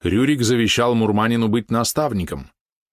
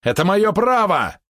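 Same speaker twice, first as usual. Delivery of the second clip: high, loud and slow; shouting; 12 semitones higher, 4 dB louder, slower than usual. Acted. Polot insists on choosing his opponent, shouting high and loud. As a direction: yell your right at them. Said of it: "прокричал Полот".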